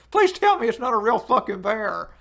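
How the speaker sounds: ordinary talk, nothing marked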